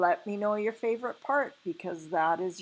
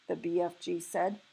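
ordinary delivery, nothing marked

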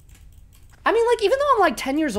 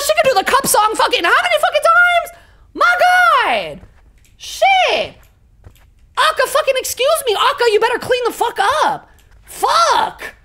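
high-pitched, loud mock rant; hammed-up exasperation; 7 dB louder than usual. Streamer scolding the game about the mess, loudly exasperated. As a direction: squeal the complaint at full volume.

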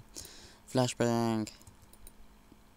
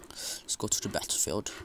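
whispered plain; 5 semitones lower than usual. Shown as a demonstration. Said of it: "It's like my American accent".

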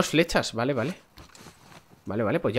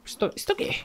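squeaky mock whisper; 7 dB quieter than usual. Mocking an in-game ad.

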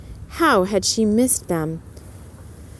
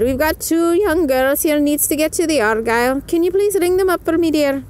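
much higher, louder character voice; Missus O'Malley voice; 8 semitones higher, 3 dB louder than usual. A narrator's reading. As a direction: lift the pitch way up and brighten. Missus O'Malley asks Peg.

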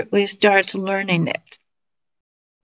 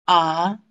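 "A" starting lower and rising steadily; second tone; 3 semitones lower than usual. Shown as a demonstration. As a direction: lift "A" upward like a question. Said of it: "imitate the tones".

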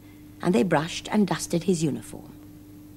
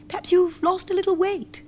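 much higher, gentle mouse voice; mice suggesting; 11 semitones higher than usual. A narrator's reading. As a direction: suggest it kindly, like a tiny mouse. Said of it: "the mice suggested".

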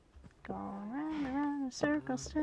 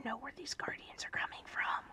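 whisper; 8 dB quieter than usual. Real, not acted.

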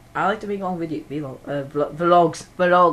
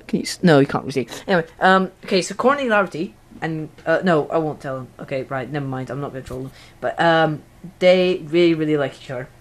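usual speaking voice

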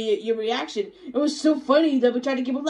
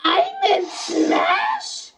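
drawn-out, high, astonished cry; shocked disbelief; 5 semitones higher, 3 dB louder, much slower than usual. Acted. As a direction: shout it slowly in disbelief. Character learns they are in Smash.

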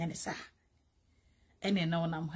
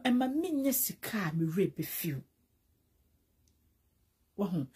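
normal talking voice

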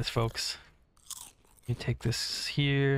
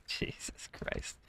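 whisper; 12 dB quieter, slower than usual. Real, not acted. Startled reaction while playing.

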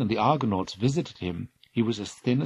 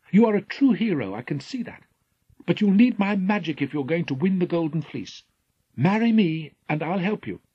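higher, lightly voiced princess; princess Medea; 8 semitones higher, 5 dB louder than usual. Princess offering magic for marriage, lightly voiced. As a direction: tempt the hero with a bargain.

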